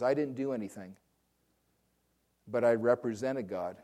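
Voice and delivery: usual speaking voice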